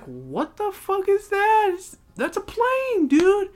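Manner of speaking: high, stretched incredulous cry; hammed-up high-pitched astonishment; mock alarm. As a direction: squeal it in exaggerated disbelief. Acted alarm at radar sighting.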